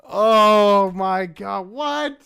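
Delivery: slow, drawn-out high oh my God; high, slow, stunned exclamation; stunned disbelief. Real, not acted. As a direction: gasp it out in disbelief. Stunned reaction.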